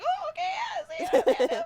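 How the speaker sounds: high, loud laughing goodbye; excited shout. Retold or imitated. Idols imitated shouting goodbye to fans, high and loud. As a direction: yell it back cheerfully.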